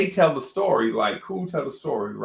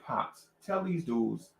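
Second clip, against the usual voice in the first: soft, low, slow request; calm appeal; 4 semitones lower, 8 dB quieter, slower than usual. Retold or imitated. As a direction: appeal coolly to a father. Kid appeals to his father.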